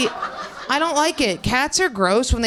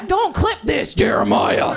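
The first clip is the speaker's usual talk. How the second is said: calling out; 5 semitones higher, slower than usual. Real, not acted. Calls out to the cameraman.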